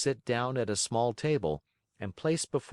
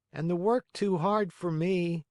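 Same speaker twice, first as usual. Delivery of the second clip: put-on high boyish puppet voice; a lightly voiced young puppet; Pinocchio voice; 10 semitones higher than usual. A narrator's reading. Pinocchio character voice.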